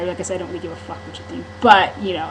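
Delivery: usual speaking voice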